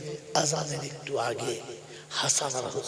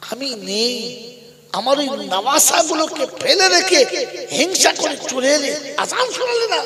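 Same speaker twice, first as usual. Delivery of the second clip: high, loud reproach building to a peak; impassioned scolding in a preacher's retelling; rising reproach; 9 semitones higher, 8 dB louder than usual. Acted. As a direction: press the accusation higher and louder. Prophet's reproach continues, rising.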